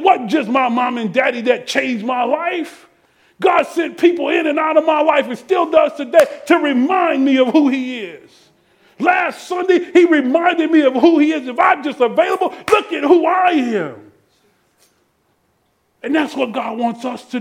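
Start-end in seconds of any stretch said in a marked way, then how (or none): 3.4-14.1 raised, ringing sermon testimony; impassioned preaching. Real, not acted. Preacher testifying to the congregation how God reminds him, impassioned. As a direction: testify with full pulpit force.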